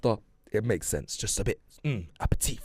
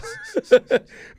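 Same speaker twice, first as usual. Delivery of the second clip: loud, high burst of laughter; sudden loud cackling laugh; real laugh; 9 dB louder than usual. Real, not acted. Laughs at own bad accent.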